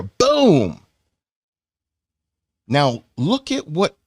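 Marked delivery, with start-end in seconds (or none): 0.0-0.8 loud, high "Boom!" shouted as an impact; a sudden explosive boom; boom sound effect. Acted. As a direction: punch out a cartoon boom.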